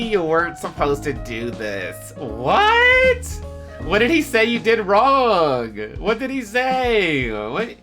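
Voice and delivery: ordinary talk, nothing marked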